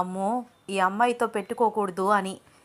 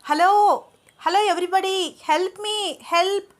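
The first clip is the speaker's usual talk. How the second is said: much higher, loud cry for help; shouting for help; 11 semitones higher, 6 dB louder than usual. A narrator's reading. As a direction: call out desperately to anyone nearby. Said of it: "అని గట్టిగా అరుస్తోంది".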